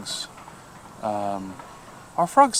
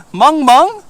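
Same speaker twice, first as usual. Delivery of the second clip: Mung mung squealed much higher and louder in disbelief; amused disbelief; 12 dB louder than usual. Real, not acted. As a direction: echo it back high, laughing in disbelief. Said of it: "Dogs in Korea are weird".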